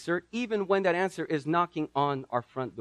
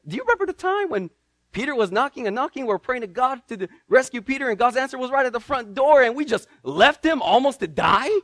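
high, fast, chuckling retelling; early believers recall the story, amused and quick; 7 semitones higher, 3 dB louder than usual. Acted. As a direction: tell it like a shared joke. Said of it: "they would repeat this story".

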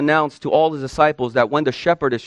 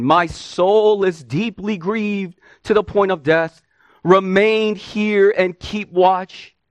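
grieved, very slow pleading; Jesus pleading with three men in anguish; slow plea; 3 semitones higher, slower than usual. Acted. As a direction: draw out each word in sorrow.

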